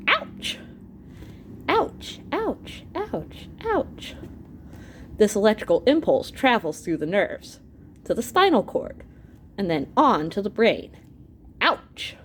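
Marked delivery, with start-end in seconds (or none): 0.1-4.1 high, squeaky cries of pain; pained ouches. Acted. Finger poked, cries in pain. 11.6-12.2 ouch. Acted. Pain message reaches brain.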